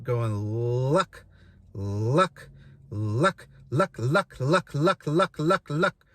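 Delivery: sharp snapping luck calls; clucking luck, tongue snapping down; goose cluck. Shown as a demonstration. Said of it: "I like to use the reference word luck".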